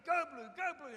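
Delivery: high, loud mock cheer from laughing friends; mates cheering. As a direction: egg him on like a rowdy mate. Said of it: "my friends were laughing at me, going".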